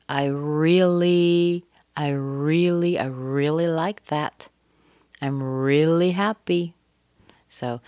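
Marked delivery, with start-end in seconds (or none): 0.1-6.7 slow stressed "really"; teacher drilling the stressed "really"; practice drill. Shown as a demonstration. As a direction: model "really" with weight each time. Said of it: "you could practice".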